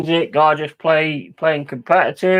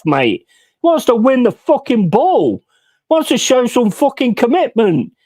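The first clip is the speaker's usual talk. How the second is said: usual speaking voice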